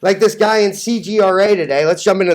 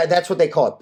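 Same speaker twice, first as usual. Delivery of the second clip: ordinary talk, nothing marked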